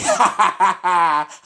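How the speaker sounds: high taunting ha ha laugh; gloating cartoon laugh; mocking laugh. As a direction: laugh in the villain's face. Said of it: "Supreme Kai's laughing at Babidi like".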